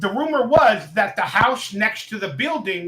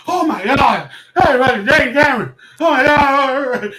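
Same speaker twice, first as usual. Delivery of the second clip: loud, high-pitched fan freak-out; starstruck freak-out; 6 semitones higher, 8 dB louder than usual. Retold or imitated. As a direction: squeal it, stretching the last cry out. Acting out his starstruck reaction.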